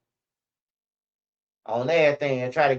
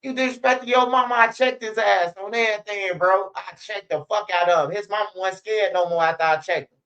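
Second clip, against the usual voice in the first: higher, heated mock outrage; indignant refusal; 4 semitones higher than usual. Scolding friend for disrespecting his mama, heated. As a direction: act shocked and scolding. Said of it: "I'm like".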